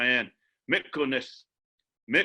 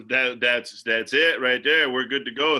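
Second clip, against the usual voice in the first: ordinary talk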